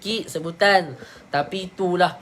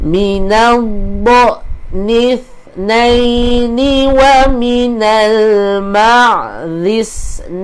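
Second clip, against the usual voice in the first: drawn-out tajwid recitation, higher; slow Quran recitation; 5 semitones higher, 12 dB louder, much slower than usual. Shown as a demonstration. Teacher repeating the verse for students to follow. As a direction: recite slowly, stretching each syllable.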